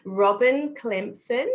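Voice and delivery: slow Australian accent. Shown as a demonstration. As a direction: spell out Robin Clemson slowly and clearly in an Australian accent. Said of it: "Say that slowly with your Australian accent".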